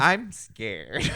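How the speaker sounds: lightly voiced scared little girl; a hint of a frightened kid. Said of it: "that line always throws me off".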